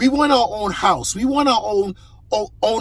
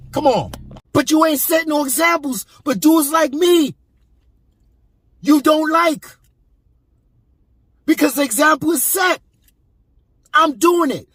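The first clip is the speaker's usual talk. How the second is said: heated call-out; 6 semitones higher than usual. Real, not acted. To the rival podcaster, a heated raised call-out. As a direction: preach it at him, voice up.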